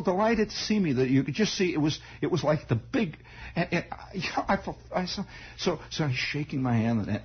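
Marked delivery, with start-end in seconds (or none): none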